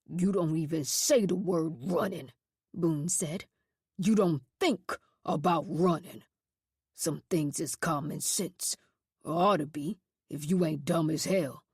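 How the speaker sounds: low, hard voice of a cruel superintendent; deep, scornful warden voice; menacing superintendent. A narrator's reading. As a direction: growl it down at the boys, threatening. Narrator voices Boone.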